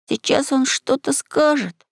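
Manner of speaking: put-on slow, high boyish wonder; high-pitched hushless awe; awed anticipation. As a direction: draw it out high in expectation. Boy awed, awaiting the machine's words.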